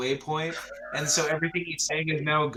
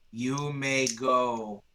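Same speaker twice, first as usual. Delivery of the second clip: very slow, drawn-out pronouncement; voice from above; 3 semitones lower, much slower than usual. Acted. Voice from above as the door opens, slow dismissal. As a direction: stretch each word out solemnly. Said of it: "and they're like".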